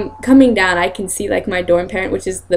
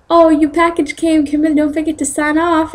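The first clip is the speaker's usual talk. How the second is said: a higher, put-on cheerful voice for the dorm parent; 8 semitones higher than usual. Dorm parent reminding her to sign for the package, high and chirpy. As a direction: play the dorm parent calling out sweetly. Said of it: "my dorm parent was like".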